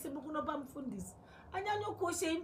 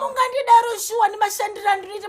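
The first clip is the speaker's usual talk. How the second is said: loud, furious, hurt; angry shouting; 12 dB louder than usual. Acted. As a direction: shout your hurt at her. Daughter rages at mother, pastor car.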